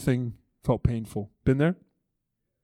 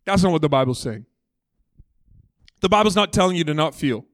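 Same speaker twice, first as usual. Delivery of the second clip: usual speaking voice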